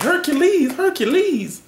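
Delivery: mock Les Miles as a giggly little schoolgirl clapping. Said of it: "like a little schoolgirl".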